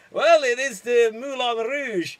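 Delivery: badly faked Scottish or Irish lilt; muddled Scottish-Irish accent. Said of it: "Scottish or Irish accent you may have".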